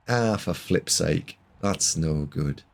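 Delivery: put-on slow, resigned Irish-accented grumble; imitated Irish accent. Commentator quoting a player's reaction, in a put-on Irish accent. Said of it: "he put on an Irish accent".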